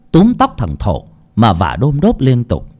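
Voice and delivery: ordinary delivery, nothing marked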